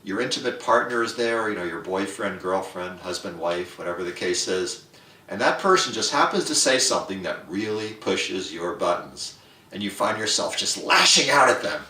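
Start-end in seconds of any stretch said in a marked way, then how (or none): none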